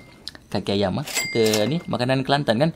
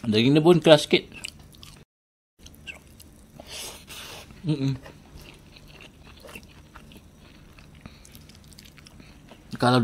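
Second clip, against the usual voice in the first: eating with hands; talking while chewing; 2 semitones higher than usual. Real, not acted. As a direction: mouth full of food. Seen 5: Bites meat, stuffs rice into mouth, chews between spoken words.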